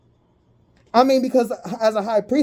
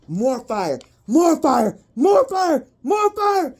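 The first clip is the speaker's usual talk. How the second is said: climbing higher with each repeat; building excitement; 7 semitones higher, slower than usual. Real, not acted. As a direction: push each more fire higher than the last. Chanting escalating attack.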